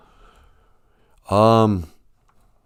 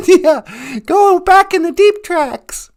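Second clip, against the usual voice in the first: very high cooing voice; high pet voice. Acted. A squeaky cooing voice praising the host's intro. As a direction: coo it like baby talk.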